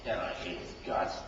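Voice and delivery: low, whispered joke; preacher's playful aside; muttered aside. Real, not acted. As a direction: slip it under your breath.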